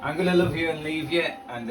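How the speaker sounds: ordinary talk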